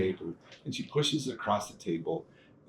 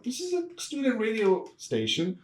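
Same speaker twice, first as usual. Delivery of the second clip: high-pitched amazed exclamation, pitch jumps way up; amazed realization; 13 semitones higher than usual. Retold or imitated. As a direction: squeal it in disbelief. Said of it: "And I'm like".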